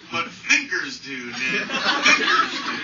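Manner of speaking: laughing through a slow, loud punchline. Retold or imitated. Quoting his friend Marty while laughing. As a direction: drag it out, cracking up.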